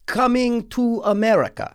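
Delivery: put-on heavy Russian accent, high. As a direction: say the title like a Russian. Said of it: "in my, you know, Russian voice".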